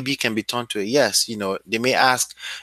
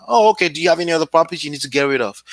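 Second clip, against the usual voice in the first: quick breezy takeaway; 4 semitones higher, much faster than usual. Shown as a demonstration. Acted takeaway reply on a call.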